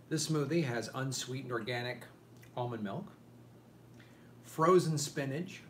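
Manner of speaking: ordinary talk, nothing marked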